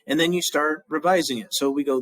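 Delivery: normal talking voice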